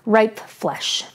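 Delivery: ordinary delivery, nothing marked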